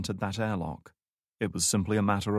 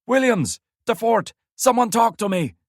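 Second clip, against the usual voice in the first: much higher, louder panicked yells; terrified shouting; 14 semitones higher, 9 dB louder than usual. A narrator's reading. Commander panicking as nobody answers the radio, terrified shouting. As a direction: scream names into the radio in fear. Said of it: "the terrified voice he'd heard".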